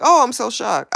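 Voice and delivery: high-pitched feigned surprise; put-on shocked reaction; mock shock. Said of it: "I wouldn't be like".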